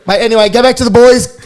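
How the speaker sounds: loud, high-pitched interruption; urgent brush-off. Retold or imitated. Radio host cutting off a player's on-air flirting, urgent.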